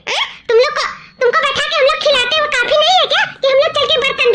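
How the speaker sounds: fast, high, barking orders; bossy scolding. Acted. Sister-in-law ordering the girl to wash the dishes, bossy. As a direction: drive her off to the sink.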